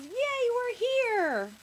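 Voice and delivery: very high, loud, long-drawn cheer; overjoyed little boy. A narrator's reading. Little boy arriving at the cafe, overjoyed. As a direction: squeal it out slowly with delight. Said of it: "whereas the little boy says".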